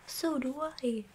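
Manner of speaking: high, simple reply; lightly voiced Pooh. A narrator's reading. Pooh returns Christopher Robin's love, lightly voiced and fond.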